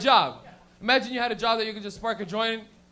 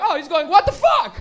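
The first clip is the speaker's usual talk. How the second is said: high, loud hammed-up outburst; excited yell; 8 semitones higher, 12 dB louder than usual. Kid imagining what the penguin says, loud excited yell. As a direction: shout it out like a thrilled translator. Child's imagined translation, acted.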